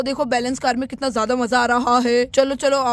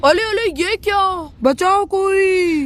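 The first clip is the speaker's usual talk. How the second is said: frightened cry; 6 semitones higher, slower than usual. Acted. Raj being pulled into the box, yelling for help. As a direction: shriek as the box pulls you in.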